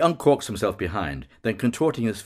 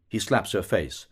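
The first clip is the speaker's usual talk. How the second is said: usual speaking voice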